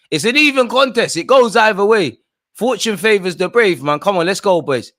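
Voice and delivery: loud, high pep-talk shout; rallying shout. Real, not acted. Fan urges his team on before the decider, loud high shouting.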